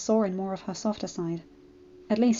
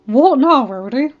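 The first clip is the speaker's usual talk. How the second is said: annoyed; 4 semitones higher, 10 dB louder than usual. Acted. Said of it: "Rainbow Dash asked".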